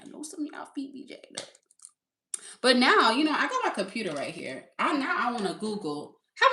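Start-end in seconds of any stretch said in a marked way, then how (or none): 0.0-1.5 very soft, half-whispered tease; hushed aside. Real, not acted. Host teasing working men about their feet, hushed. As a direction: say it under your breath.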